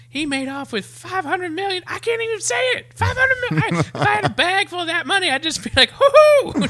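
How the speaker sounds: very high, building to a loud squeal; Sinbad impression. Retelling Sinbad's Madoff joke, squealing high impression. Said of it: "You know, he's like".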